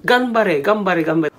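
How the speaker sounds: acted encouraging chant; cheering. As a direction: urge an athlete on in Japanese. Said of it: "when cheering someone on".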